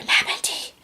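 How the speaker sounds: soft whisper into her ear. Acted. Whispering into the sleeping nanny's ear. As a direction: whisper her name to wake her. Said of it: "I started whispering in her ear".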